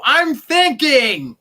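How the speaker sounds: loud, high, drawn-out yell. Acted. Yelling at someone honking at a green light, loud shout. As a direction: holler it out a car window. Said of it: "to yell out the window".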